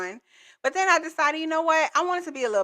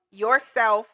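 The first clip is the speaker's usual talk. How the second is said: ordinary talk, nothing marked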